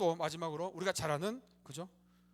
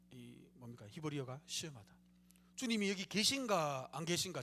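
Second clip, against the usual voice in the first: normal talking voice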